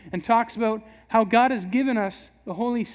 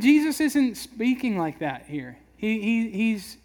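ordinary talk, nothing marked

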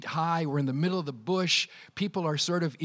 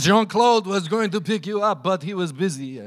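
put-on a light Russian accent on the friend's words; 4 dB louder than usual. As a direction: voice Pierre with a mild Russian accent. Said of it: "All my accents eventually sound Russian".

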